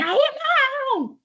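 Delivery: very high, slow, drawn-out cry of dismay; retold heckler's drawn-out high shout; distant shout. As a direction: yell it like someone far off begging you to stop. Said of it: "eu ouvi uma voz gritando assim".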